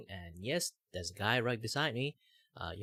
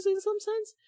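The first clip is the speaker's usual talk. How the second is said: squeaky high voice; 6 dB louder than usual. Real, not acted. Sudden squeaky voice mid sentence.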